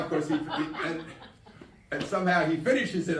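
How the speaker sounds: ordinary delivery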